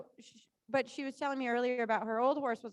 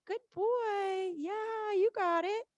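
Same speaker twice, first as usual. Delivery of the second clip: high praise to horse; 8 semitones higher, much slower than usual. Real, not acted.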